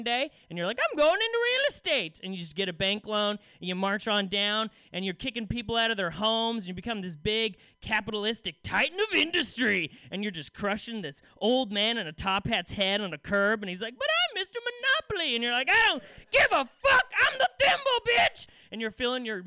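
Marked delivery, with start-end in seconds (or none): 0.8-2.2 squeaky high, loud little thimble. Acted. Sentient thimble announcing its career, squeaky high voice. 14.0-15.4 put-on high, loud protesting old man; Mister Monopoly pleading. Crushed Monopoly man protesting to thimble, high squeal. 15.7-18.5 high, loud, snarling little thimble; ruthless thimble. Acted. Thimble sneering at Mister Monopoly, high loud snarl.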